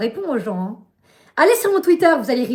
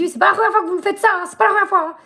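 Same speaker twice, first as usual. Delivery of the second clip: higher, louder, fast retold complaint; fast, higher, louder mock tirade at the operator; retold angry complaint; 8 semitones higher, 6 dB louder, much faster than usual. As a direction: rattle it off, fed up with the provider. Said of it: "Et je lui dis".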